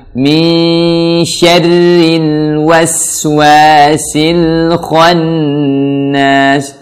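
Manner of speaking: "waswasil khannas" slowly chanted, L flowing, no bounce; flowing tajweed recitation. Shown as a demonstration. Teacher modelling verse for learners, slow flowing chant of "waswasil khannas". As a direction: chant each syllable long, let "waswasil" flow into "khannas".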